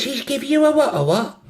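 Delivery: put-on very high London accent; incredulous in put-on London. London girl questioning her friend's news, London accent. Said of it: "have a go at the accents".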